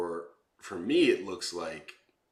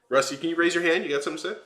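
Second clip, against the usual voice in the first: raised, higher voice calling across the call; 10 semitones higher, 6 dB louder, faster than usual. Real, not acted. Host calling out to a silent guest, louder and higher.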